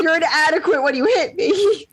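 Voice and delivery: high-pitched scorn breaking into laughter; giggling put-down; laughing mock taunt. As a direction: jeer while cracking up. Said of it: "continuing mock taunt".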